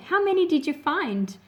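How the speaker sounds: ordinary delivery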